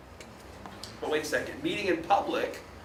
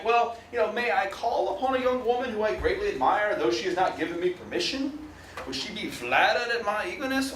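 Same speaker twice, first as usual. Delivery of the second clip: lecturer voicing a 19th-century suitor to the class, mild Southern accent; Southern antebellum accent; 4 semitones higher than usual. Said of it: "I don't know why they would say it in that voice".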